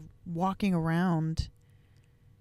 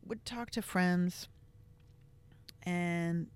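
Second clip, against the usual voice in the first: ordinary talk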